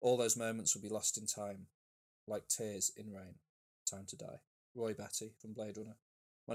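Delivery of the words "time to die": normal talking voice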